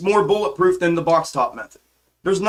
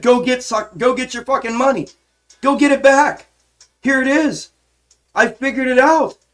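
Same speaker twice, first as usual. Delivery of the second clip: ranting yell; 6 semitones higher than usual. Retold or imitated. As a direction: shout the challenge at everyone. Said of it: "I'm sorry if I'm ranting and yelling".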